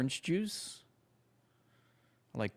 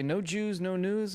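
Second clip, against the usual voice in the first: higher mock quote; 8 semitones higher, 4 dB louder than usual. Quoting saying again.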